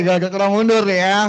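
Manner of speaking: ordinary talk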